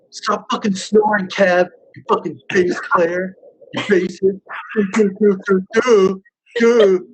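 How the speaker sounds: high, drawn-out sleepy rant; mock mad. Waking up to berate the snoring bass player, mock mad. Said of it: "be so mad".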